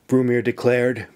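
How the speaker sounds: plain reading voice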